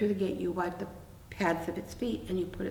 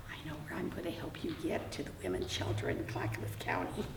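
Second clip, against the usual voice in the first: whispered aside, much softer; conspiratorial whisper; 2 semitones lower, 8 dB quieter than usual. Real, not acted. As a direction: let it slip as a secret. Private aside about the shelter.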